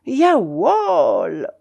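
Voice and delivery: "Jawohl" cried out, long stretched O; sudden shout. A narrator's reading. Narrator startling the baron Berlin-style, drawn-out shout. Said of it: "criai-je tout à coup et de toutes mes forces".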